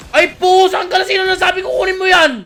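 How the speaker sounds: high, loud outburst of protest; shouting. Real, not acted. Streamer scolding a teammate for taking it, shouting. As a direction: shout it in sudden outrage. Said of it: "Hindi na daw sisigaw".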